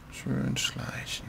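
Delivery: a slow, very soft creeping whisper; hushed sneak. Pretend. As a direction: creep and breathe it.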